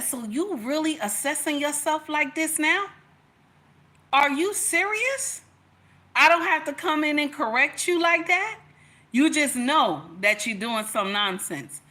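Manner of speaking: hammed-up, incredulous husband telling her off; imitating scolding husband. Husband correcting his sulking wife, mock indignant. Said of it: "Now, he didn't say it like that".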